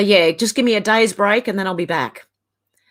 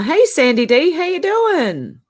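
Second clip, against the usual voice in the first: bright sing-song hello, pitched higher; cheery greeting; 7 semitones higher, 3 dB louder than usual. Real, not acted. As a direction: call out hello to a friend, pitched up. Greeting a viewer in chat.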